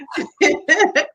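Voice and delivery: light, amused laugh; high laugh. Real, not acted. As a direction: laugh at her energy. Guest laughs at host's energy.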